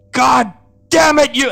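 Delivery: loud high furious curse; angry curse. Acted. Hunk cursing at his sister, furious. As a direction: spit it out in rage.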